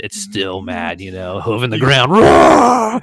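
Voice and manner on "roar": high, loud bellowing bull roar; bull scream. Retold or imitated. Narrator imitating the calf's scream in the ring, high and loud. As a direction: let out the calf's huge scream.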